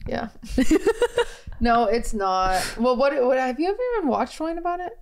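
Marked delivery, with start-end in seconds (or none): 0.3-1.5 high giggle burst; high burst of laughter. Real, not acted. Laughs at own joke.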